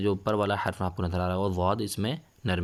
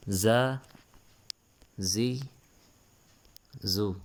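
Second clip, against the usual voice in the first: Qaida recitation drill; 3 semitones higher, much slower than usual. Shown as a demonstration. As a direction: call out the letters so the class can repeat. Letter drill chant.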